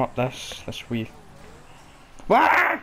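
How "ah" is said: sharp yelp. Real, not acted. Sudden frustrated yelp.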